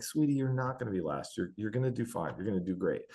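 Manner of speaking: dad calming his daughter before her race; reassuring dad. Retold or imitated. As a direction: soothe her worry, quietly.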